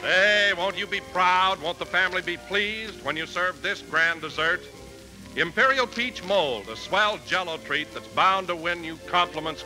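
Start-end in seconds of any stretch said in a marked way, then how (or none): none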